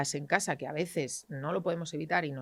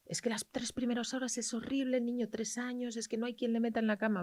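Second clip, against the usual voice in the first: exasperated parent; 7 semitones higher than usual. Retold or imitated. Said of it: "muy habitual".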